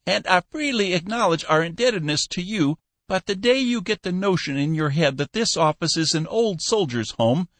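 put-on high piping old man, teasing; old Cappy Ricks. Old boss teasing his new clerk, high piping voice. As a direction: squeak it out like a spry old boss.

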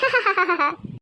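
mock evil "ha-ha-ha" cackle; gloating villain laugh. Schemer gloating over the stolen ID card, villainous laugh.

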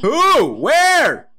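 high alarmed questions; startled. Real, not acted. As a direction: ask in sudden alarm.

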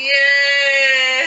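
cheering. Real, not acted. Cheering the baby.

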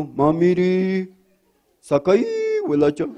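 slow, high Tarija chapaco singsong; chapaco accent. Acted. Woodcutter pleading in a slow chapaco accent. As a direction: stretch it out like a chapaco. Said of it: "A lo chapaco".

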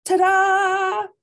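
high, loud, drawn-out flourish; blasted fanfare. A narrator's reading. Band blasting as Scapito flies up, fanfare. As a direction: blast it out like a trumpet. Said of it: "blasted Labando".

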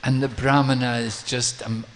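ordinary delivery

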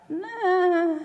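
high, soft dismissive nah. Retold or imitated. Preacher mimicking her own dismissive thought, high nah. Second reaction to reading.

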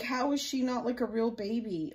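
ordinary talk

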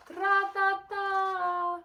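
high, loud, slow drumming tra-ta-ta; loud slow rat-a-tat; knocking sound. Acted. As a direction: imitate a woodpecker hammering the branch. Strange knocking sound wakes Kevin.

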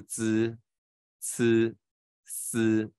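滋、呲、丝 one by one with a flat tongue; spaced-out teacher's demo of 滋、呲、丝 as zi, ci, si; flat-tongue sibilants. Shown as a demonstration. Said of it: "平舌音声母".

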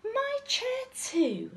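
high, drawn-out cry of dismay; slow, high-pitched bear mother's wail; Mummy Bear. A narrator's reading. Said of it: "cried Mummy Bear".

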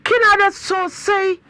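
loud exclaiming. Real, not acted. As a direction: draw it out high and loud. Host continues calling out loudly.

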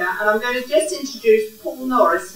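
ordinary delivery

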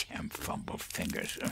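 whispered grumble to himself; grumbling. Acted. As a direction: curse under the breath, barely voiced. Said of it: "he grumbled".

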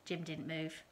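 plain reading voice

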